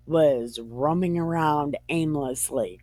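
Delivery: normal talking voice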